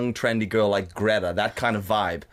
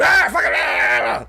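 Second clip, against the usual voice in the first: higher, louder, slow mob yell; mock mob shout; 9 semitones higher, 6 dB louder, much slower than usual. Street mob going at it, mock slow yell. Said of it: "group of people out in the street going".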